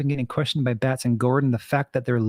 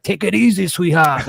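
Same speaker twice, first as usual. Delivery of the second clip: louder, higher, cartoonish New York accent; mock East Coast New York accent; 4 semitones higher, 5 dB louder than usual. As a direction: belt it out as a New York parody. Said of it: "doing an East Coast New York".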